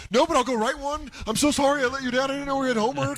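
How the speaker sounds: very high-pitched mock apology; sarcastic comeback. Comic answering Attell's demand for jokes, sarcastic.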